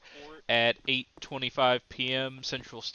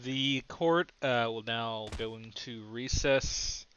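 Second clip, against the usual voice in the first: ordinary talk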